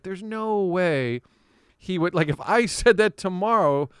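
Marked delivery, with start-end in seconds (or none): 0.0-2.1 slow, drawn-out disbelief. Retold or imitated. Reacting to Tony's radio answer, slow disbelief. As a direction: stretch it out, can't believe it. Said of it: "And I'm like".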